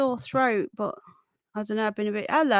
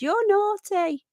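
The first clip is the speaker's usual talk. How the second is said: high, slow teasing scold; mock scolding; 9 semitones higher, 4 dB louder, slower than usual. Streamer mocking YouTube scolding her, high and slow. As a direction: wag a finger in a high, slow voice.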